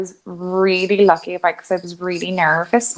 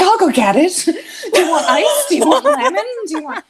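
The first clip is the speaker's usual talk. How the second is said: much higher, giggly eager-to-please offers; overeager girlfriend; 11 semitones higher than usual. Retold or imitated. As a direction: gush like an overly helpful girlfriend. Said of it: "really fucking overcompensating and going over the top".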